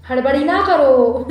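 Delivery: loud, drawn-out playful plea; mock plea for patience. Partner telling an eager lover to slow down, mock pleading. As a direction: stretch it out loudly, teasing.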